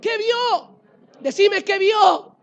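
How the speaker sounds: rising from a high question to a full shouted answer; building to a shout. Real, not acted. Preacher to the congregation, climbing to a shouted reveal.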